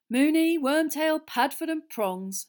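slow, loud, wistful sigh; fond sigh. Acted. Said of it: "sighed George".

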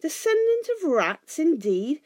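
very high, loud affronted repeat; high-pitched huffy outrage; indignant echo. A narrator's reading. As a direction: throw the insult back, offended. Bilbo indignantly echoes the insult.

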